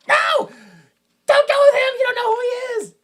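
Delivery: very high, loud panicked shriek; frantic mom. Retold or imitated. Mom screams at her son not to go, frantic shriek. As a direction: scream it as a hammed-up mother. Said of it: "and the mom's like".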